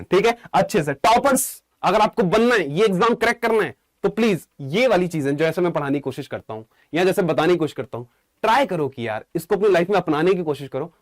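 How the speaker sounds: usual speaking voice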